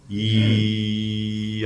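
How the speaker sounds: "이" held long on one note; sustained 이. Shown as a demonstration. Monk showing the moment of questioning, sustained "이". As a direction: intone "이" slowly, without moving the pitch. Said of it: "이렇게".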